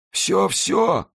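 high-pitched, eager cry; desperate exclamation. A narrator's reading. Dying man promises the monk everything, crying out high. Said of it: "воскликнул палач".

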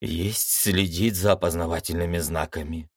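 usual speaking voice

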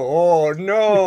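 imitating a drawn-out cry of dismay; espanto imaginado. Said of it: "salió el logo de Sanrio y dijo". (lamento exagerado, en broma)

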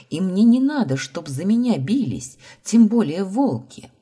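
loud, high-pitched objection; heated refusal to be fought over; agitated protest. Acted. Sister protests against fight over her.

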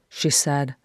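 plain reading voice

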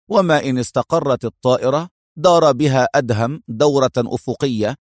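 plain reading voice

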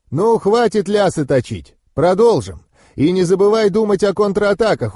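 high, energetic drilling voice; exacting mentor urging a pupil on; demanding vampire mentor. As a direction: lecture the pupil sternly and briskly back into training.

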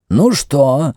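high-pitched questioning priest; Mefres the priest. Acted. High, expectant question. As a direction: priest challenges his colleague after the demonstration, high and insistent. Priest challenges his doubting colleague.

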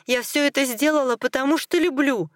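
high-pitched, heated cry of protest; shouting back. A narrator's reading. Wife protests to her furious husband, crying out high. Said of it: "закричала она в ответ".

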